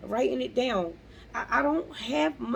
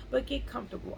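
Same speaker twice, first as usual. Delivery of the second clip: normal talking voice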